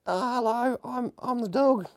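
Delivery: high, slow silly dog voice; dog's voice. Pretend. Voicing the owner's little dog, high and slow. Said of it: "it's not how he talks".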